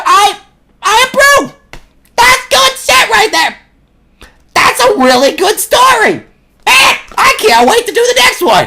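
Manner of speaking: hammed-up shouted delight; put-on enthusiastic voice. Hammed-up praise of story.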